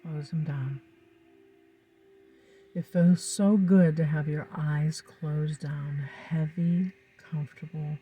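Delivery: calm, soothing hypnotic guidance; hypnotist. Acted. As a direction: lull the listener into trance. Leads a hypnosis session over music.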